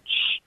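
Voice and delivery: imitated unvoiced shush; mimicked finger-to-lip shush; hushing sound. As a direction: hush someone with a drawn "shh". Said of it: "he just went".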